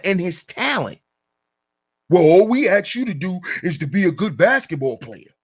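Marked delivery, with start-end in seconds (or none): 2.1-5.3 mocking belittling quote. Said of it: "look at how he's belittling his character".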